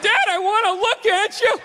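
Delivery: put-on high-pitched whiny child voice; pleading kid. Kid coming up the walk to dad, high pleading voice. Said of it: "Kid's coming up to the walk".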